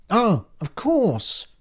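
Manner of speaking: high, loud, drawn-out "oh" of realisation; high-voiced receptionist catching on; sudden realisation. A narrator's reading. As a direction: let the penny drop, slowly. Lucy realises she can phone.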